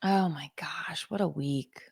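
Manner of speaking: soft breathy whisper, slow; exhausted whisper. Real, not acted. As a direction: sigh it out, drained.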